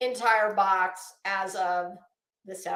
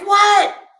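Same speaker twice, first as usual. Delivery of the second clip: husband's shout; 9 semitones higher, 10 dB louder than usual. Retold or imitated. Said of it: "My husband's like".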